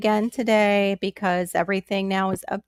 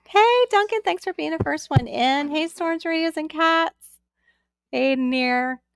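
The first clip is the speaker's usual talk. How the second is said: excited greeting; 7 semitones higher than usual. Real, not acted. Greeting chat viewers on livestream.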